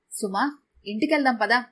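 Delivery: plain reading voice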